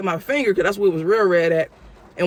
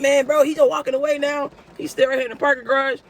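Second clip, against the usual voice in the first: urgent; 7 semitones higher than usual. Retold or imitated. Urgent report on phone.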